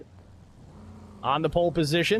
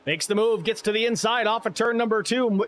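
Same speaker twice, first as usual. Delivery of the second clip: raised, urgent play-by-play; race caller; 7 semitones higher than usual. Acted. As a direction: call the pass like a track announcer. S0 calls the battle live.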